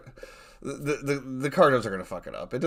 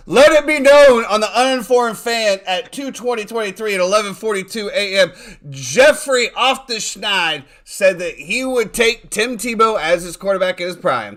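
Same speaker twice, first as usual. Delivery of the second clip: high, gloating town-crier announcement; raised, celebratory declaration; mock proclamation; 7 semitones higher, 6 dB louder than usual. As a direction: proclaim it triumphantly for the record. Gleefully proclaims co-host's admission for record.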